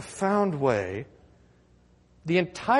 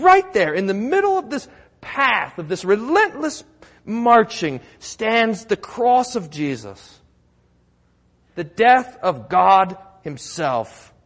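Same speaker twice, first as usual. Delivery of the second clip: full force preaching; 3 semitones higher, 5 dB louder, slower than usual. Acted. Pastor hammers home Jesus' victory, fervent and loud. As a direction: preach it hard to the congregation.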